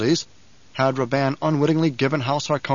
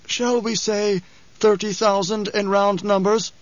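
a higher, slow, probing mentat voice; Hawat; 7 semitones higher, slower than usual. A narrator's reading. Hawat questioning the Baron about troop losses, measured. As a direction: press the question carefully.

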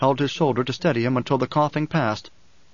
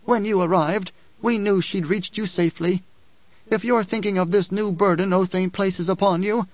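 higher character voice, measured and firm; Dory, firm woman; 6 semitones higher than usual. Acted. Dory urges Paul to take the dwarf, firmly. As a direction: lift the voice for a resolute woman.

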